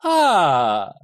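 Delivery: long, loud delighted exclamation; loud theatrical cheer, falling away; cheerful. A narrator's reading. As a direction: draw it out brightly with delight. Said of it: "a cheerful voice said".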